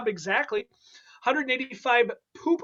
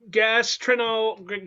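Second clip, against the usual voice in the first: ordinary delivery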